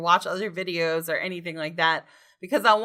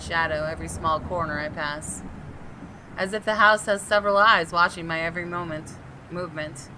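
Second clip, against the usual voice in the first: steady, measured first-person narration; story narration; 4 semitones lower, 4 dB quieter than usual. Acted. Reading the detective's inner monologue to viewers, measured narration. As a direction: read it like a tense mystery novel. Reads game narration aloud.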